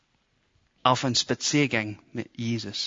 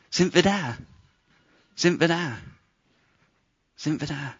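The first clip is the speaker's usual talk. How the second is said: whining; 3 semitones higher than usual. Retold or imitated. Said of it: "die fangen an zu meckern".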